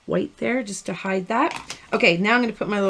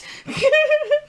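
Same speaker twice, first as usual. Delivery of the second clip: high laugh. Real, not acted. Laughing at haircut reaction.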